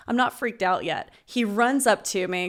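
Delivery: usual speaking voice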